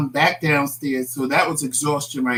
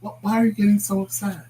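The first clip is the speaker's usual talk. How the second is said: imitating husband; 4 semitones higher, 4 dB quieter than usual. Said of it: "he just acts like it's no big deal".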